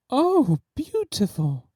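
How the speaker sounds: a high, breathy sigh of admiration. A narrator's reading. Duchess sighing over her portrait, a high sigh. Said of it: "sighed her Grace".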